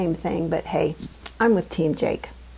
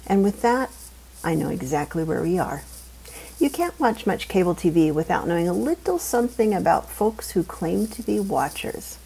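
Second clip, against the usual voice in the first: plain reading voice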